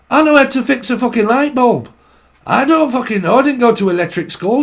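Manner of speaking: fed-up northern husband and wife having a row; bickering elderly couple. Acted. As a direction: argue back indignantly as an old married pair. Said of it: "a couple that proper goes at it".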